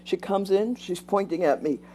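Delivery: normal talking voice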